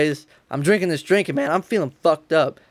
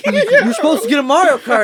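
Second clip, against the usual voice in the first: higher, laughing through the quote; laughing disbelief; 9 semitones higher, 5 dB louder than usual. Retold or imitated. Son protesting the swapped gift, laughing in disbelief. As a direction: giggle out the protest. Quoting himself laughing at Christmas.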